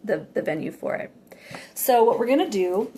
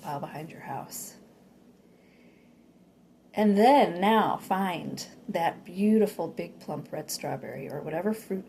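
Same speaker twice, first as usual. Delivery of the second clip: guided meditation; 4 dB quieter than usual. Acted.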